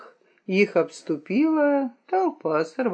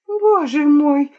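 high, loud, drawn-out exclamation; crying out; 8 semitones higher, 5 dB louder, slower than usual. A narrator's reading. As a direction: cry out in alarm. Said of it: "крикнула хитраска". (громкий высокий вскрик)

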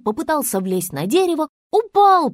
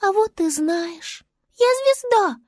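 high little character voice; high-pitched star creature; 9 semitones higher than usual. Acted. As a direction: answer back in a high, tiny voice. Star answers hare in character.

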